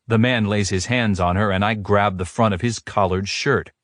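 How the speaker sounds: ordinary delivery, nothing marked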